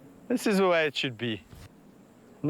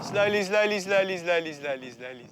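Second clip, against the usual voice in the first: soothing coaxing; 4 semitones higher than usual. Real, not acted. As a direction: hush the animals gently down. Calming hyenas.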